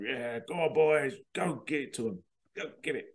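hearty send-off from the generals; lightly voiced general waving troops off; cheering generals. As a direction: cheer the boys off to war like a smug officer. Generals saluting departing soldiers.

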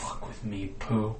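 menacing threat. Acted. Mickey threatening Pooh.

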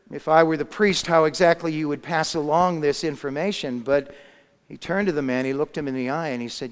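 normal talking voice

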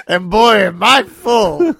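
loud, slow, satisfied glutton; hammed-up gloating after a meal; smug cannibal voice. Jokes as the cannibal.

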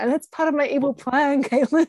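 laughing through a playful villain line; laughing joke. Real, not acted. Coach teasing Caitlin about her evil plan, laughing. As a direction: chuckle as you confess the mock scheme. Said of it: "joking about her evil plan".

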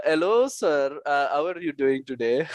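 playful put-on Indian accent. Shown as a demonstration. Showing the host a risky accent, imitated Indian. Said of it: "Indian is more".

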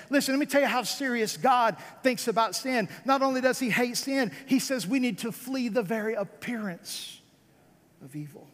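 ordinary talk, nothing marked

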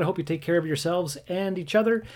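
ordinary talk, nothing marked

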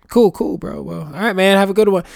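high, loud breezy bro voice; mock casual dude cheerfully ending the chat; fake-friendly small talk. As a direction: brush them off with a chummy bro send-off. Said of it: "the kind of conversation that you have with someone".

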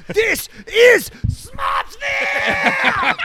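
high, slow epic yell; epic shout. Pretend. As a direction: bellow it like a gladiator movie. Shouted movie-quote parody.

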